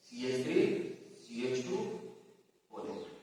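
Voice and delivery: low, soft, piece by piece with pauses; writing aloud. Real, not acted. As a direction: murmur the formula as you write it. Writing the same formula on board.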